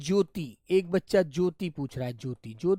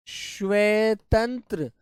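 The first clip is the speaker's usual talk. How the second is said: stretched out word by word; drawn-out teaching delivery; slow dictation; much slower than usual. Shown as a demonstration. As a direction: spell it out slowly for the class.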